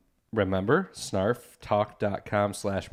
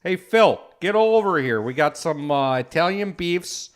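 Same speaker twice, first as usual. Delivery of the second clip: put-on loud, higher Chicago Polish accent calling a buddy over; 4 semitones higher, 4 dB louder than usual. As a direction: yell across the bar in a thick Chicago Polish accent. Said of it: "we're doing a Polish accent".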